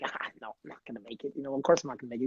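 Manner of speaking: quiet, low, quick mumble of resignation; resigned shrug. Retold or imitated. Young player mumbling to the border guard, resigned and quiet. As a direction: mutter it like of course not.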